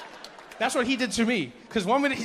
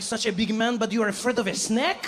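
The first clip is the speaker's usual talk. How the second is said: higher, mocking French accent; French accent, incredulous question; 5 semitones higher than usual. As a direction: tease him in French-accented English. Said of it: "the French guy turns to me".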